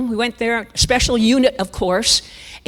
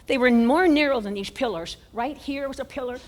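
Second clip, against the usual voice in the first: ordinary talk, nothing marked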